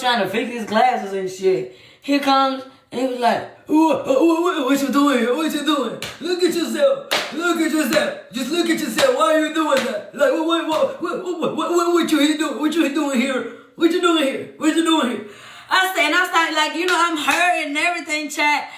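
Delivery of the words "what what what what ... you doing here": imitating a stammering, caught-out man; flustered partner. Cheater caught outside hotel, flustered stammering. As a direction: stammer it higher, mocking his fluster.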